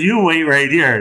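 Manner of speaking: high, loud mock order; lightly played bossy robber; commanding robber. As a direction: order the captive to stay put. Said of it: "And so he says".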